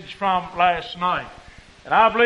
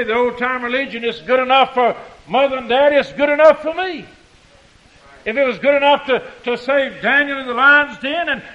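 raised, higher preacher's voice; preaching fervor; 4 semitones higher, 5 dB louder than usual. Real, not acted. Preacher recalling the old-time religion song, fervent. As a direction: drive it home with mounting force.

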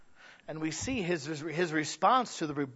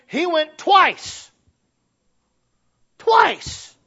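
loud, slow, high "Twice"; preacher's stunned, rising "Twice" repeated; emphatic twice; 9 semitones higher, 8 dB louder, much slower than usual. Acted. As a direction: hammer home the shock. Pastor's prison testimony emphasis.

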